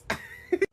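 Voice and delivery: sudden high-pitched cackle; high squeal of a laugh. Real, not acted. Laughing at her own revenge plan, gleeful squeal. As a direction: let out a shrill gleeful laugh.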